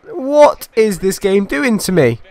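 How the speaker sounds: high, slow, drawn-out outburst; exasperated rage. Real, not acted. Gamer asking what the game is doing to him, furious. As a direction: stretch the words out in fury.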